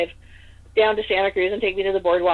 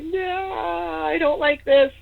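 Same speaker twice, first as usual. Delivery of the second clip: frightened; 9 semitones higher, much slower than usual. Retold or imitated. Recalling terror of the haunting.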